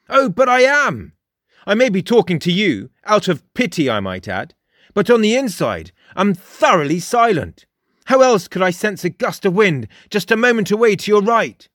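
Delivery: high, emphatic self-assured character; cocky high character voice; boastful Frost. A narrator's reading. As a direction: boast grandly, pitched well up.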